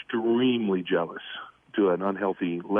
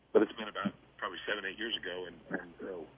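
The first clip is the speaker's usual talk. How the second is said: fading phone line; 9 dB quieter, faster than usual. Real, not acted. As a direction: voice fading away over a bad phone line. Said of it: "Your voice is fading quickly".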